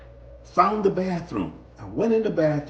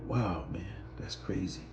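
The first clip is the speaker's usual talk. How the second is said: much softer, lower muttered relief; relieved hush; 8 semitones lower, 10 dB quieter than usual. Retold or imitated. Rider alone in the stall, hushed relief. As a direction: let the fear drain out.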